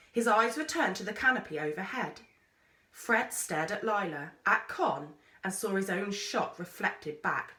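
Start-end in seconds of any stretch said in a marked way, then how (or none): none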